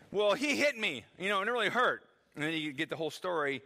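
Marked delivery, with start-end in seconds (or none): 0.1-2.1 lightly voiced kid's protest, louder and higher; hurt little tattletale, lightly imitated; complaining child. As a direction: plead your case like a kid. Voicing a complaining child in retold dispute.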